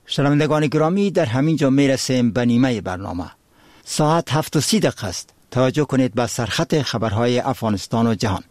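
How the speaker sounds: measured formal news read; solemn formal newsreader. Acted. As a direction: read the headlines with gravity. News bulletin read by anchor.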